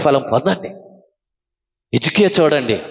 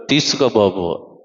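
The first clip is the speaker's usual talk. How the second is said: low, slow, gentle voice of God; God granting gently; 8 semitones lower, slower than usual. Retold or imitated. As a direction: hand it over kindly, like a father. God's voice answering Hezekiah. (దేవుని మృదువైన, నెమ్మదైన గంభీర స్వరం)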